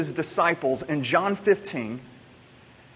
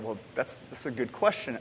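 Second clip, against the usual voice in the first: inner thought, hushed; 7 semitones lower, 11 dB quieter than usual. Retold or imitated. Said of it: "And I thought".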